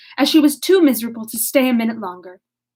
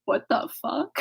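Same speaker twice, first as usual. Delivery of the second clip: stunned Eleanor; 2 semitones higher, 8 dB quieter than usual. Acted. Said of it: "Eleanor was like".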